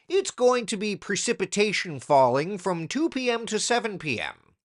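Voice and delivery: stiff forecaster-speak, high and loud; mock jargon. Mocking jargon you'd never say to your cousin. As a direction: parody an overformal weatherman. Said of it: "you're not going to say to your cousin".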